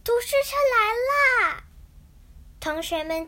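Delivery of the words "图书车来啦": excited announcement. A narrator's reading. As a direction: call out that it has arrived. Children greet arriving book truck.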